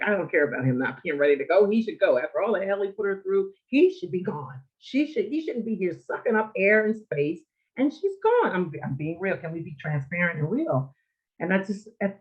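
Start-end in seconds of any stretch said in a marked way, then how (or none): 0.0-8.5 high-pitched, heated tirade climbing at the end; retold anger. Daughter resenting father outliving her mother, retold anger. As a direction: rant indignantly, pitch rising to the last cry. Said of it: "I was just so angry".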